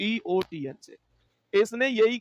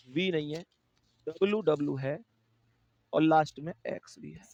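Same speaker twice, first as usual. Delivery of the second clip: whisper; 3 semitones lower, 4 dB quieter than usual. Real, not acted. Whispered end of letter list.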